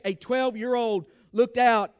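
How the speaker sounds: ordinary delivery, nothing marked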